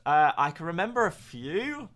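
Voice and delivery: normal talking voice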